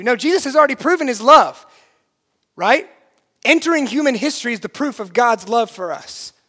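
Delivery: loud, high, impassioned proclamation; full-force preaching. Acted.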